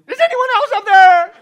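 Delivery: very loud, high-pitched cry to heaven; desperate yell. Acted. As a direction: yell up in acted panic. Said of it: "And the tourist yelled".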